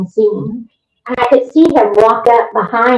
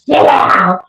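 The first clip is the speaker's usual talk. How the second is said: imitated sharp command; possessed man's order; 3 semitones higher than usual. Possessed man ordering the investigator to sit, commanding. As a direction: order it like a domineering spirit. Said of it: "he goes".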